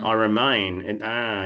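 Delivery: "I remain" with the Australian vowel stretched out; a touch of Australian drawl. Shown as a demonstration. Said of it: "tend to stretch the vowels out".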